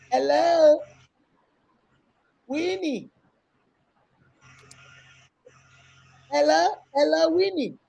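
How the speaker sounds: high-pitched repeated calls; calling out. Real, not acted. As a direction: keep calling to raise a silent guest. Calling a guest who doesn't answer.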